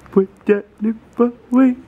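high, evenly spaced words; teasing baby command. Pretend. Third take, higher.

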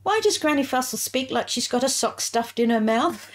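put-on high, innocent child's question. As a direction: imitate a curious little kid. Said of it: "and I said".

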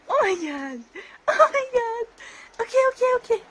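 high, loud, laughing overwhelm; excited laughing. Real, not acted. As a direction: squeal it out, swamped by dogs. Dogs jumping all over her.

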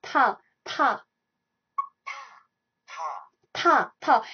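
Ta said short and crisp; pronunciation demo. Shown as a demonstration. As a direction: model the syllable ta twice. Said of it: "it has a sound of ta".

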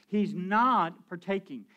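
not drawn out slow and heavy; He's not partaking, every word slow, not weighted; slow stress on not. Shown as a demonstration. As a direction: lean hard on not, slowly. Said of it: "Now, listen to me. Watch me".

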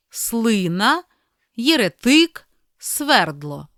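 each word read alone, with a clear stress and a pause after it; чітко, по слову, з наголосом; reading words aloud. Shown as a demonstration. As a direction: read out the list word by word, leaning on the stressed syllable. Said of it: "прочитаймо їх вголос".

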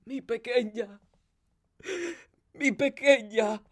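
high, stricken murmur; distraught old nurse's lament; stammering grief. A narrator's reading. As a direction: falter it out in a high grieving voice. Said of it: "Victoria balbucía".

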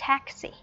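TAX in "taxi" punched high, si dropping away; stress on first syllable. Shown as a demonstration. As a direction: hit the first syllable of "taxi", then fall. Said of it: "mình sẽ nhấn mạnh hơn vào âm đầu tiên". (nhấn mạnh âm đầu của "taxi")

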